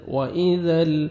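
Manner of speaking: ordinary delivery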